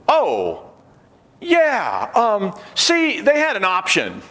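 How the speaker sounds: hammed-up nervous excuse from the fake graduate; caught faker, mock flustered. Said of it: "And he said".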